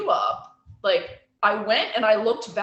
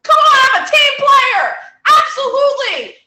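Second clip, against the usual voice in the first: high, loud, laughing team-player enthusiasm; hammed-up shouted eagerness; mock eager shout; 13 semitones higher, 9 dB louder than usual. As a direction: oversell it like a gung-ho cheerleader. Exaggerated eager salon owner.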